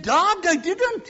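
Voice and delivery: put-on little boy protesting in a high Welsh lilt; Welsh accent. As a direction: plead loudly in a Welsh accent. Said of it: "He didn't say it with a Welsh accent".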